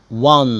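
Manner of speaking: won said exactly like one. Shown as a demonstration. المدرس ينطق won زي one. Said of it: "كأني بقول number one".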